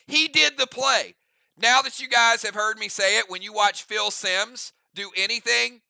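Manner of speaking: ordinary delivery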